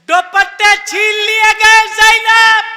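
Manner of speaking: keen the grief of Karbala; wailing lament. Acted. Lamenting majlis recitation.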